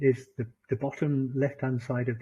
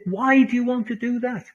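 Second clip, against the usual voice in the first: higher, louder incredulous question; retold disbelief; 9 semitones higher, 6 dB louder than usual. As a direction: ask as if baffled. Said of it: "I said".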